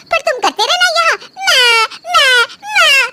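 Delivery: high, whining mockery of "माँ, माँ, माँ"; mocking whine. Wife mocks her husband for always calling his mother, whining. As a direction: mimic a mama's boy, whining.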